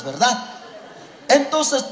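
normal talking voice

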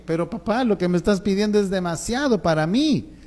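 overwhelmed boy's protest, acted out; protesting child. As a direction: plead that it's far too much, voice lifting. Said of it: "Mi hijo se va a quedar así como que".

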